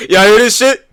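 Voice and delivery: high, very loud disbelieving cry; incredulous shout. Real, not acted. Streamer asking viewers if they hear this, shouting incredulously. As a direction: holler it at the audience, scandalized. Repeats the call after laughing.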